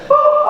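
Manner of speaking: very high chirped beep like a navigator reroute; high GPS beep. Retold or imitated. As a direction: make a little high electronic ping. GPS sound effect.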